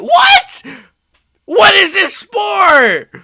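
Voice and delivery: furious high yelling, slow and drawn out; outraged shouting. Real, not acted. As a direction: scream at the ref's blunder. Ref stopped fight then restarted.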